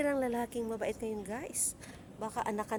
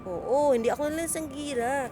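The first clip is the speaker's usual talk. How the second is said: louder, higher protest; indignant denial; 5 semitones higher, 7 dB louder than usual. Real, not acted. Denying to viewers she's a drunk, loudly indignant. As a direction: push back hard.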